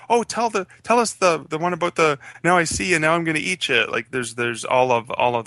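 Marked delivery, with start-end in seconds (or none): 0.1-3.9 put-on kids begging. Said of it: "They go".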